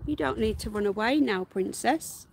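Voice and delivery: normal talking voice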